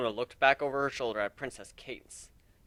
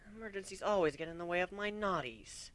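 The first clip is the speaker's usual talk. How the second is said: pouty; 7 semitones higher, 6 dB quieter than usual. Acted.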